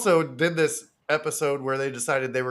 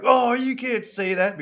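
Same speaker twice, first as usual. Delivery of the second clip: higher, hammed-up scandalised gasp of a line; mock shocked; 10 semitones higher than usual. The gang scolding Frank, mock shocked. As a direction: clutch the pearls, lightly. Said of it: "they were like".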